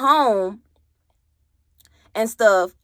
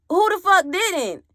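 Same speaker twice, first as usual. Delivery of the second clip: higher, indignant snap-back; indignant retort; 9 semitones higher than usual. Retold or imitated. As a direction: fire it back in disbelief. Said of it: "I said".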